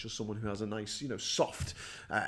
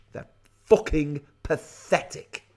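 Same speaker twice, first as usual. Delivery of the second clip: scornful outburst; 4 semitones higher, 7 dB louder, slower than usual. Real, not acted. Rant at English deference.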